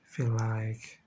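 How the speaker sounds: low, hushed, slow channeling voice with long pauses; eyes-closed channeling, quiet and halting; trance-like channeling. Shown as a demonstration. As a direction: speak slowly and softly, letting each phrase arrive unplanned.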